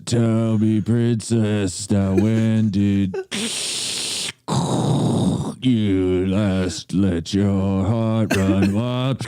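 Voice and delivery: low, slow, breathy-free Vader croon; Darth Vader voice. Pretend. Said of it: "Darth Vader singing [name]".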